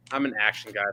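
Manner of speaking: ordinary delivery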